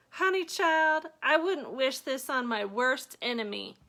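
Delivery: put-on high, loud old grandma voice, dead serious; grandma's voice. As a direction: play a dramatic old grandma. Grandma voiced in retold story.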